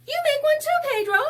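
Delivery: very high, loud call to a friend. Acted. Girls calling Pedro to join the snow angels, high loud call. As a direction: shout it up high across the snow.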